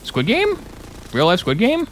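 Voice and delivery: high incredulous squeal; high squeaky disbelief. Real, not acted. Streamer squeaks at the Squid Game news, incredulous.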